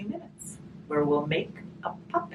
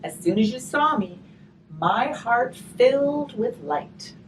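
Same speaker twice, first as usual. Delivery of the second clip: put-on reading to children; 7 semitones higher, 9 dB louder than usual. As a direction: read the rhyme aloud to the children. Reading a rhymed picture book aloud.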